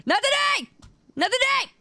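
shouting refusal. Real, not acted.

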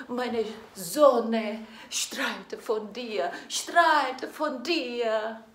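ordinary talk

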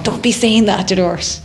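slight imitation of a worried manager's warning; a hint of scolding manager; lightly voiced manager. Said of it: "your manager's going".

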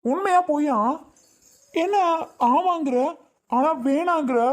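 high, demanding disbelief; husband demanding why she refuses the baby, high and angry; angry confusion. Acted. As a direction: demand a straight answer.

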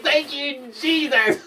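high, joyful thank-you; grateful exclaim. Retold or imitated. Keys found in the paper bag, joyful relief. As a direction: cry out in relief. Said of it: "I was like".

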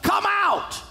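high ringing shout of a name; Jesus calling loudly into the tomb. Retold or imitated. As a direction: call him out of the tomb. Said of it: "called out in a loud voice".

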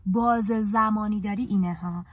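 ordinary talk, nothing marked

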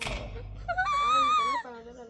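high panicked shriek; frightened shriek. Real, not acted. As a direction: shriek as lights go out. Lights going out in game.

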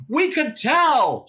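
high, loud, drawn-out retort; shouting back. A narrator's reading. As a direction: yell it back slowly. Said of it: "shouted Ford".

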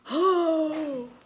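sharp loud gasp of alarm; a startled intake of breath. Real, not acted. Startled by the baby on camera, sharp gasp.

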